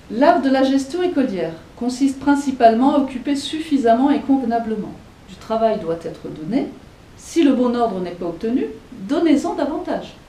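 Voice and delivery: reading a quote aloud. Acted. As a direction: declaim the rule as if from the book.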